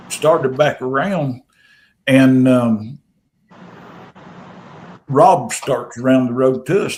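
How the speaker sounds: ordinary delivery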